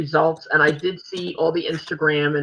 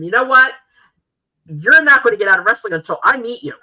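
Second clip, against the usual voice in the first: higher, louder, insistent vow; emphatic declaration; 4 semitones higher, 8 dB louder, faster than usual. Retold or imitated. Fan telling wrestler he can't retire yet, emphatic. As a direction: lay it down forcefully, as if to him. Imagined vow addressed to her idol.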